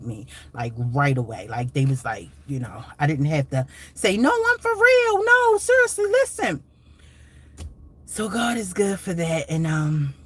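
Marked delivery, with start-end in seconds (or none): 4.2-6.6 much higher insistent mock voice; mock pleading. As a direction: plead to be believed in a high put-on voice. Imagined plea to disbelieving family.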